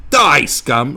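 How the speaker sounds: loud, high battle yell; mock furious shout. Imagined attack on someone he disagrees with, mock furious yell. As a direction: bellow it like an over-the-top attacker. Acted attack on racist, not done.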